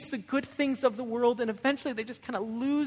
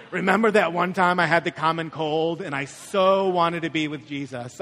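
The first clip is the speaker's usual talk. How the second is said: loud, teasing exaggeration; comic, emphatic teasing of a child; mock reminiscence; 3 semitones lower, 6 dB louder than usual. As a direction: ham it up loudly as playful sarcasm. Teasing the son's dramatic wish.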